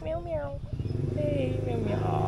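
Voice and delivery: very high, slow cooing meows. Real, not acted. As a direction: coo at the kitty. Baby talk to a cat.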